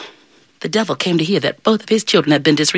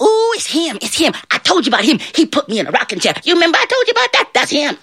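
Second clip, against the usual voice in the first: high, excited chatter; scared little devils; 12 semitones higher, faster than usual. Acted. Little devils peer at John and recognize him, scared. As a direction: babble fearfully in little high voices.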